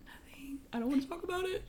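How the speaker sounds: tiny whispery squeak; mock tearful refusal. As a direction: squeeze it out high and voiceless, holding back tears. Tearful refusal retold to sister.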